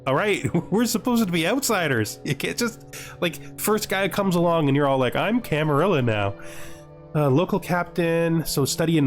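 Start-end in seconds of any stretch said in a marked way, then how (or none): none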